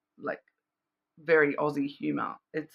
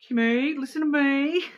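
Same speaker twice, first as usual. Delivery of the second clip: higher, louder sitcom mum line; a hammed-up broad Australian call; broad Aussie quote; 9 semitones higher, 4 dB louder than usual. Quoting Kath and Kim's mum, broad Aussie accent. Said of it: "an Australian show called, um, Kath and Kim".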